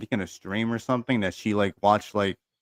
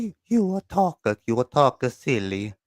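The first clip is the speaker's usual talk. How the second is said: Consuelo voice; 3 semitones higher than usual. Retold or imitated. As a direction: chide like Consuelo. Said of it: "you imagine Consuelo from Family Guy".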